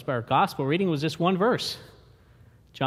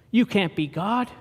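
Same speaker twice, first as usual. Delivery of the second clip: usual speaking voice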